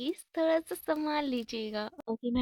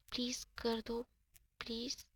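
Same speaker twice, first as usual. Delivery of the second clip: much softer, coaxing please; soft, affectionate begging; sweetly pleading; 8 dB quieter than usual. A narrator's reading. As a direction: plead gently with a friend. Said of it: "बहुत प्यार से उसे बोल रही होती है".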